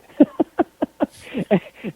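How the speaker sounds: real chuckling fit; amused laugh. Real, not acted. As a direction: laugh at your own story. Amused by own story.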